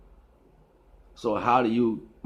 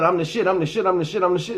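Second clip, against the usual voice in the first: mock bragging yell; 7 semitones higher, 3 dB louder, faster than usual. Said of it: "you keep yelling".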